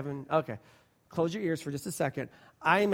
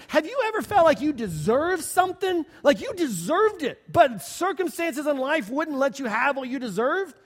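mock pleading defendant; 7 semitones higher, 6 dB louder than usual. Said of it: "And she goes".

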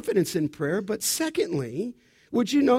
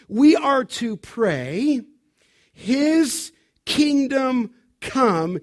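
raised, emphatic pulpit voice; forceful preaching; 3 semitones higher, 4 dB louder than usual. Real, not acted. Preacher telling the congregation it is not about them, forceful preaching. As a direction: drive each point home, weighty and insistent.

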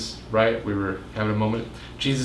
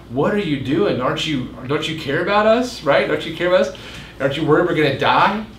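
raised, pleading mock panic; panicked disciples; 5 semitones higher than usual. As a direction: act out the frantic disciples in the boat, voice pitched up.

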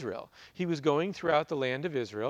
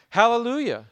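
high, loud ringing Hallelujah; loud cry; 8 semitones higher, 8 dB louder than usual. A narrator's reading. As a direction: call it out like a whole crowd in heaven. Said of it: "crying out".

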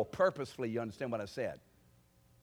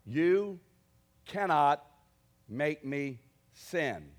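louder, slow, each word spaced out; defiant rebuke; 3 semitones higher, 8 dB louder, much slower than usual. Shown as a demonstration. Preacher shows how he rebukes the tempter, slow and loud. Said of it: "I will say to this slime".

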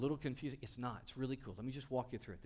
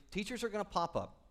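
ordinary talk

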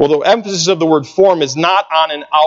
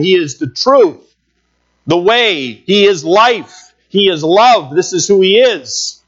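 higher-pitched, driving sermon litany; impassioned preaching; 5 semitones higher than usual. Real, not acted. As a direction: preach each attribute with rising fervour. Preacher builds list of Christ's attributes.

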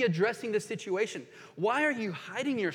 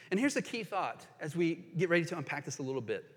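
normal talking voice

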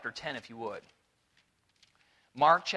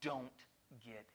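hushed command; 6 semitones lower, 12 dB quieter than usual. Retold or imitated. Jesus's command voiced quietly.

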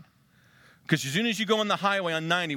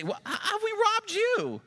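much higher, stammering mock innocence; mock protest; slower than usual. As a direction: play the guilty people pretending surprise. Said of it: "You say".

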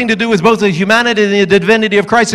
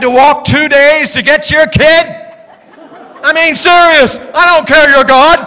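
mock-outraged outburst, higher and louder; exasperated parent; 5 semitones higher, 3 dB louder than usual. Parent who walked two days for the kid, exasperated. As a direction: blow up like a fed-up parent. Imagined fed-up parent of Jesus.

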